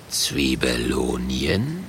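low, slow suspicious growl. A narrator's reading. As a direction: growl the word out slowly. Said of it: "knurrt der Murk".

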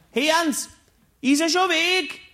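squeaky little groundskeeper asking; Platzwart-Stimme. Acted. Platzwart spricht zum Erzähler. (hohe, langsame Platzwart-Stimme)

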